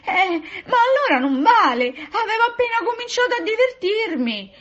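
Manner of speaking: very high, loud lady's complaint; high wail of indignant disappointment; whining protest. A narrator's reading. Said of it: "che protestava".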